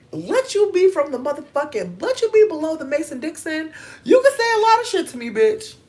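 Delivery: loud, high-pitched gushing outburst; excited gushing. Real, not acted. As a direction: squeal it loudly, carried away. Gushing over Southern accents.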